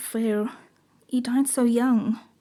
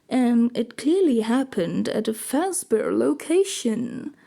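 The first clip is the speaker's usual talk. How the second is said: normal talking voice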